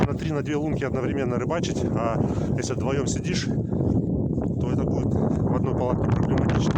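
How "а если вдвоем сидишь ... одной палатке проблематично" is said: gusty wind roaring over the mic; talking outdoors into strong wind. Real, not acted. Wind noise, outdoors on ice.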